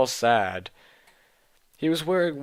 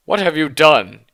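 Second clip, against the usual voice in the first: much louder, higher hammed-up outburst; crying out; 4 semitones higher, 12 dB louder than usual. Dumbledore storms in and confronts Ebony, loud mock cry. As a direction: blast it like a mock tragic wail. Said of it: "he started to cry wisely".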